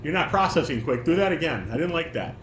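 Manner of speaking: ordinary delivery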